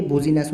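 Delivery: "बूजीनेस" with the middle I voiced; three syllables, "boo-zee-nes", in "बूजीनेस"; wrong pronunciation. Shown as a demonstration. Teacher showing what happens with I sounded, mispronounced. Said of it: "आई का sound लेंगे".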